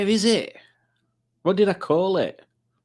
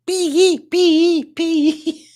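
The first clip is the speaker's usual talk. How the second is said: very high, playful squeals on each word; silly mimicry; 10 semitones higher, 4 dB louder, much slower than usual. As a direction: squeak each one out like a daft jingle. Mimics the clip, laughing around it.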